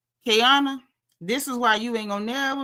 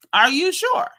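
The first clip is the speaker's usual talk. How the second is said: doubtful; 5 semitones higher, 4 dB louder than usual. Acted. Repeated with rising doubt.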